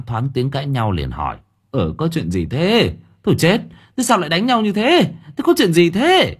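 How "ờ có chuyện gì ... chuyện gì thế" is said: alarmed uncle. A narrator's reading. Uncle arrives at the fight.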